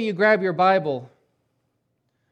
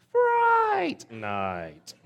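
high, delighted repeat of the title with a laugh; gleeful; 12 semitones higher than usual. Real, not acted. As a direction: savour the title like a treasured memory. Gleefully repeating favorite movie title.

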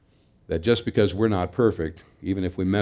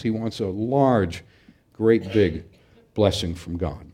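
ordinary talk

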